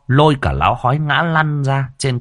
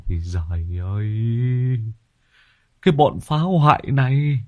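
despairing lament; 3 semitones higher than usual. A narrator's reading. Breathless, wrecked victim lamenting.

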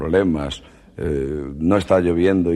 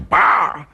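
smack sound effect; 9 semitones higher, 3 dB louder than usual. Retold or imitated. Umbrella hitting the arm.

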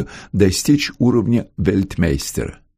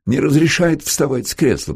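higher, mild grumble; complaining; 7 semitones higher than usual. A narrator's reading. Patient grumbles to Anton about his sister's ban, complaining. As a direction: lodge a mild complaint in raised pitch. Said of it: "пожаловался он Антону".

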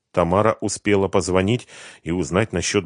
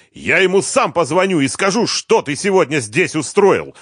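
higher and louder, a hint of an angry threat; angry promise; 11 semitones higher, 6 dB louder than usual. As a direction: threaten him with mild acted anger. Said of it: "зло пообещал".

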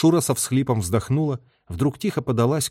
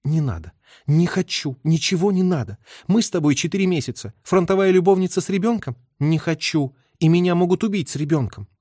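high, agitated woman's voice; bitter outburst building in pitch and force; angry; 7 semitones higher, 3 dB louder than usual. A narrator's reading. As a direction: lash out at him, pitched high. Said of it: "Оттуда сказала злым голосом".

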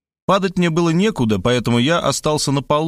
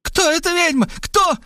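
high female yelling voice; yelling woman. Acted. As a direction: demand to know, very high. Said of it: "вопила". (высокий женский вопль)

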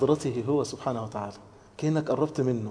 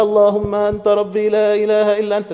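higher, chanted in one run without pauses; rattled-off recitation; 5 semitones higher, 6 dB louder than usual. Shown as a demonstration. Preacher showing youths how not to say the prayer, rattled off. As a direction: recite it by rote in one high, even run. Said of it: "مش عايزكم تقولوها زي ما بنعملها كده".

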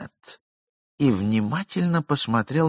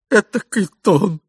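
indignant exclamation; 11 semitones higher, 8 dB louder than usual. Acted. Indignant reply in heated argument.

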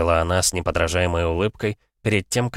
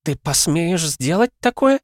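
crestfallen; 11 semitones higher, 4 dB louder, slower than usual. A narrator's reading. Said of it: "упавшим голосом".

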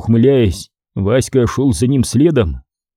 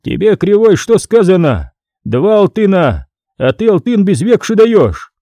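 raised, stern voice of a frowning clerk; scowling scribe; 7 semitones higher, 4 dB louder than usual. A narrator's reading. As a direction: scold him from above, higher and louder. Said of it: "писец нахмурился".

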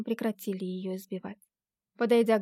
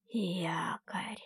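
low, menacing whisper; sinister whisper; 4 semitones lower, 8 dB quieter than usual. A narrator's reading. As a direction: breathe it out like a threat. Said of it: "зловещим шёпотом прошептал он". (зловещий шёпот)

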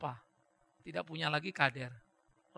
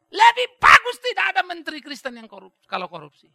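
shouted speech; 10 semitones higher, 19 dB louder than usual. Retold or imitated. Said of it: "Dia teriak begitu".

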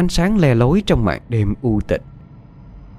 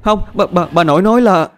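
put-on a much higher little girl stammering in fear; terrified stammer; 9 semitones higher, 4 dB louder than usual. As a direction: stutter it out in panic. (bé gái lắp bắp sợ hãi)

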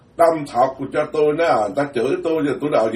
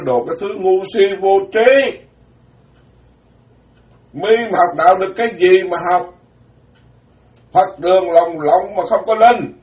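raised, louder mock scolding; scolding master; 5 semitones higher, 5 dB louder than usual. Master telling off an ignorant disciple, retold scolding. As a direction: berate a foolish student, pitched up. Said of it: "Ông thầy ông chửi cho".

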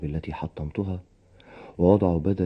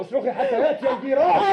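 very high, raging shout; furious; 4 dB louder than usual. Acted. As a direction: taunt her at the top of your voice. Husband attacking, taunting wife. (بصياح هائج)

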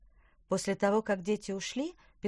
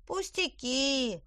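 modest elf; 8 semitones higher, much slower than usual. Acted. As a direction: humbly brush it off. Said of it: "скромно ответил эльф".

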